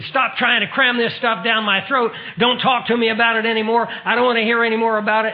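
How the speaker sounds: the atheist, fed up, yells at my friend to stop, fast and loud; angry yelling. Retold or imitated. Atheist yelling at the preacher to stop, loud and fast. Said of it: "He started yelling at him".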